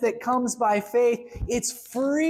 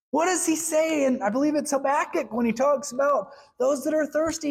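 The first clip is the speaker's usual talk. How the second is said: ordinary talk, nothing marked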